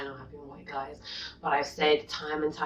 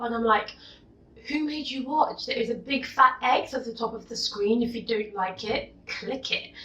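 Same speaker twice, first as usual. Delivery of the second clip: higher, heated, fed-up outburst; angry rant; 7 semitones higher than usual. Real, not acted. To commenters who hate her looks, high heated rant. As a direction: let the trolls have it. Ranting back at the trolls.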